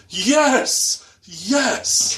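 high, loud, drawn-out cheer; triumphant cheer. Retold or imitated. Rider cheering stranger who kicked the wagon, elated shout. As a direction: celebrate like your side just scored, voice soaring.

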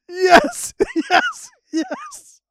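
high, loud Yes chant; crowd cheering. Retold or imitated. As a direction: shout it like a cheering arena.

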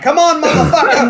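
high, loud taunting yell; mock battle cry. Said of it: "Putin would be on top of the plane like".

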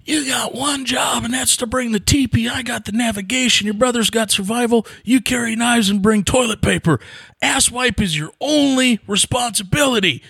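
high, shouted angry dad lecture; a dad scolding his son about the teepee; gruff dad yelling. Retold or imitated. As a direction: bark orders like a fed-up father on a hunt. Dad chewing him out.

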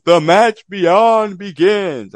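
big, drawn-out announcer call, high and loud; ring announcer. Acted. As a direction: proclaim it slowly like a wrestling announcer. Mock ring announcement of match phase.

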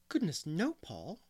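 amazed. A narrator's reading. Teacher answers boy's worried question, amazed. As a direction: exclaim in astonished denial. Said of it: "exclaimed Anne in amazement".

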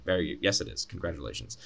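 low, dropping away to an unimpressed murmur; dry mock congratulations. Answering strangers about his name's spelling, dryly sarcastic. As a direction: humour them with tired sarcasm. Retold reply to a tiresome question.

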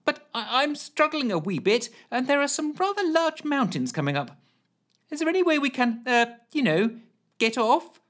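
high polite strained voice; polite Ellen. A narrator's reading. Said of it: "trying to sound as polite".

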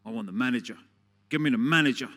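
a furious shopper yelling for the manager; irate customer. Retold or imitated. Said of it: "this really irate woman".

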